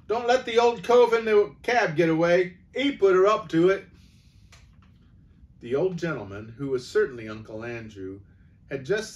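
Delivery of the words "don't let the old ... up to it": high, loud shout from the back; called out from the crowd. Acted. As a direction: yell a warning over the crowd. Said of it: "someone else called out".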